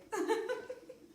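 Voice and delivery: bright high burst of laughter. Real, not acted. Laughing at own accent bit.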